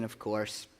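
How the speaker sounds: ordinary delivery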